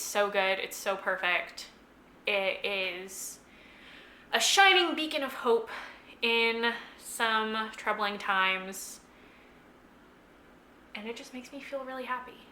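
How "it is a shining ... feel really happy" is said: getting emotional. Real, not acted. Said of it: "I'm getting emotional about this book".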